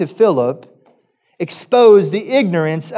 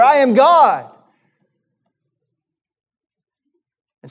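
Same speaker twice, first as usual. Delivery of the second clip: higher and louder, urgent preacher climax; preaching at full force; 6 semitones higher, 3 dB louder than usual. Acted. Pastor urging the church to remember Christ, fervent preaching. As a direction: press the point home to the congregation.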